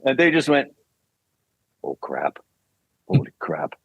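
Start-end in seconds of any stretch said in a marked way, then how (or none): 1.8-3.8 low, hushed awe; stunned. Retold or imitated. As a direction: drop your voice in stunned disbelief. Retold stunned producers after scream.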